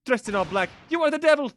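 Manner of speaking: a startled Russian woman crying out at an intruder; Russian lady accusing. Acted. Russian passenger shrieking at the intruder, accusing. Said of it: "the Russian accent".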